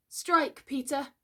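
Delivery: put-on high, resolute boyish voice; firm. A narrator's reading. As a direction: bid him do it without flinching. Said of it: "he said firmly".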